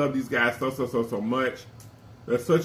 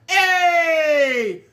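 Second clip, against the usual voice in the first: hype shout; 13 semitones higher, 5 dB louder than usual. Pretend. Hype shout closing chant.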